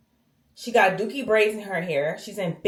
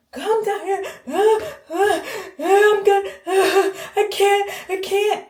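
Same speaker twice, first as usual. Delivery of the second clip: much higher, sobbing and wailing; imitating mother crying; 11 semitones higher than usual. Mom crying on the phone to her daughter, high wailing sobs. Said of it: "crying, crying, crying".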